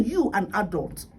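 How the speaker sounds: usual speaking voice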